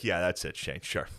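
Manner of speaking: quiet, mostly low annoyed grumble; low retold irritation. Sober man to his needling son, quietly irritated. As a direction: answer with contained anger, imitating him. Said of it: "He's always so fucking angry".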